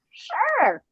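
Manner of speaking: very high, unsure little voice; mock-timid high-pitched reply; hesitant squeak. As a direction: squeak out a doubtful agreement. Acting out her unsure agreement.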